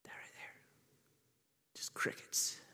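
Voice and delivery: hushed whisper, barely a breath. Acted. Silent crowd, hushed telling.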